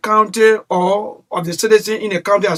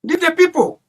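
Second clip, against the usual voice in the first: heated high appeal; 9 semitones higher than usual. Real, not acted. Caller pressing the official to quit.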